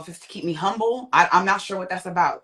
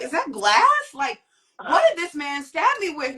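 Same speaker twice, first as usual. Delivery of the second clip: alarmed disbelief; 10 semitones higher than usual. Retold or imitated. Realizing glass in arm.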